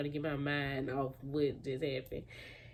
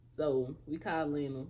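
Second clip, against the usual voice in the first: ordinary talk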